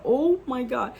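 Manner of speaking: mock-panicked cry of dismay; retold panic. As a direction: replay your alarm, stretching the "oh". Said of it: "I'm panicking".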